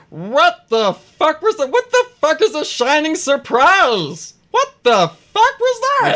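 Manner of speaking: very high, loud, drawn-out gnome shriek; outraged gnome. Acted. Gnome reacting to the exploding punch, high outraged shriek. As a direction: squawk it slowly, far up in pitch. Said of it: "the gnome's just like".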